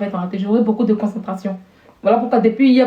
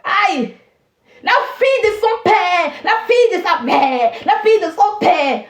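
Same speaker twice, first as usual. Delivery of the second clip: jubilant; 7 semitones higher than usual. Real, not acted. Student cheering herself as her parents' daughter, jubilant. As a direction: crow it proudly, pitched up. Celebrating herself after good marks.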